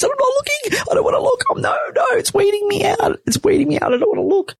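very high squealed refusal, acted out; freaking out. New mother refusing to look at her baby, freaking out. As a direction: panicky squeak, pleading to be spared the sight. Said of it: "but I was freaking out".

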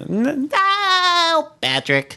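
high cartoon sponge squeal; SpongeBob voice. SpongeBob voice for the podcast listeners. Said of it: "where SpongeBob, it's just a stupid laugh".

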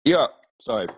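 talking right after a sip; words just off the straw; sipping through a straw. Real, not acted. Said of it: "I needed my iced coffee".

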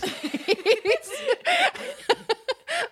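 bursting into high laughter; a big helpless laugh; loud laugh. Real, not acted. Host bursts out laughing at story.

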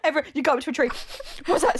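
excited dog voice, sniffing. Pretend. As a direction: play a curious dog sniffing. Acting a dog at a tree.

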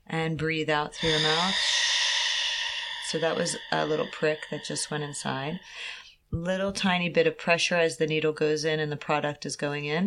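hushed, calming voice; soft and soothing. Real, not acted. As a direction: talk her gently through it. Calming patient during injection.

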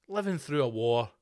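ordinary delivery

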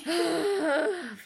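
big, loud sigh of surrender; hammed-up exasperated sigh; exaggerated sigh. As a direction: sigh heavily as if giving in. Giving in to the teasing.

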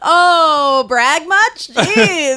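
sarcastic teasing. Real, not acted. Teasing friend's humblebrag.

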